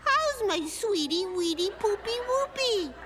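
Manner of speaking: mock a mother's baby talk. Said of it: "the mother said".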